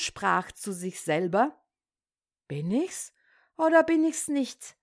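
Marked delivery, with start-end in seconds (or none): 2.5-3.1 tief ansetzen, mit Frage im Tonfall nach oben; grübelnd, sich selbst prüfend; fragend zu sich selbst. Acted. Katerlieschen fragt sich selbst. 3.6-4.8 „oder“ hoch angesetzt, dann fallend; höher nachgefragt. Acted. Katerlieschen fragt sich selbst.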